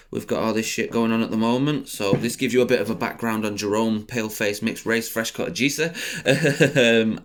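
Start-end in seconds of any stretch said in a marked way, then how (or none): none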